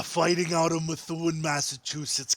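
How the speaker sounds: put-on loud, slow Boston accent. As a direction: drawl it out Boston style. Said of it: "say Calvin's name again in the Boston accent".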